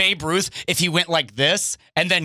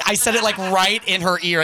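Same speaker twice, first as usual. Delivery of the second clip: ordinary talk, nothing marked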